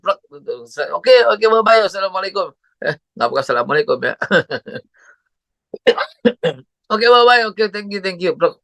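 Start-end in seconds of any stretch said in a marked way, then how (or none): 1.0-2.5 higher, bouncy sign-off; upbeat phone farewell; cheery goodbye. Retold or imitated. 6.9-8.6 higher, bouncy sign-off; upbeat phone farewell; cheery goodbye. Retold or imitated.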